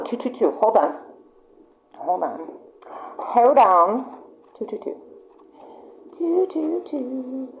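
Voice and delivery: hushed, trailing off to herself; quiet aside. Real, not acted. Reader pauses the reading, hushed to herself. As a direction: murmur it while checking.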